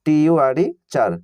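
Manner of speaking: slow letter-by-letter spelling, then future; spelling out slowly. Shown as a demonstration. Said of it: "যেমন দেখো". (ধীরে বানান করে পড়া)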